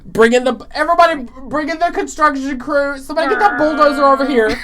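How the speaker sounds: hollering for the bulldozer like a site boss; mock foreman shout. Joking about revamping the show, a mock foreman shout. Calling in construction crew bit.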